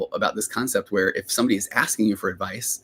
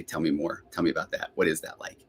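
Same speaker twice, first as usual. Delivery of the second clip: gentle listening parent; 4 semitones lower, 5 dB quieter than usual. Acted. Parent drawing out a kid's feelings, gently. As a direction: lower and softer, calmly inviting.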